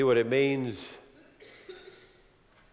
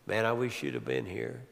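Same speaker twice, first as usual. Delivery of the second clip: soft, hushed, lower lament; grieving reproach; 4 semitones lower, 7 dB quieter than usual. Acted. Mary telling Jesus he came too late, quiet grief. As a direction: let the words sink with sorrow.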